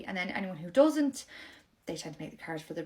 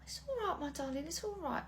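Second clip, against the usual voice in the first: softer, higher soothing voice; tender comfort; 8 semitones higher, 7 dB quieter than usual. Acted. Nursery worker soothing the crying little girl, softly. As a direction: hush a crying toddler gently.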